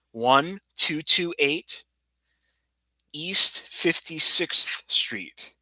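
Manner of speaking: slow, clear, spelling out the address; slow dictation. Real, not acted. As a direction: read each number out slowly.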